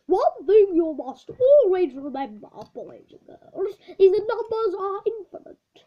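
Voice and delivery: a hint of a schoolmaster lecturing the class; old teacher voice. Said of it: "the old maths teacher, Mister Shrewdly".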